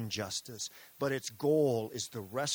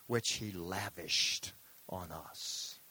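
slow savoring read; 5 semitones lower, much slower than usual. Shown as a demonstration. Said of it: "I love this Greek word".

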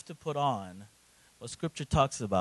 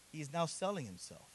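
ordinary delivery

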